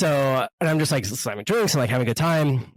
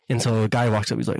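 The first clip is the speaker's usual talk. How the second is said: normal talking voice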